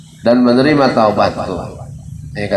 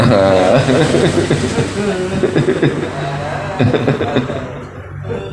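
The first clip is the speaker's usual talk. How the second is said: high, airy burst of laughter; hearty laugh; 6 semitones higher than usual. Real, not acted. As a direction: laugh at your own joke. Preacher laughs at his own joke.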